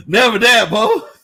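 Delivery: ordinary delivery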